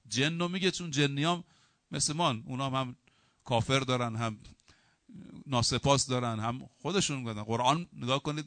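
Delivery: normal talking voice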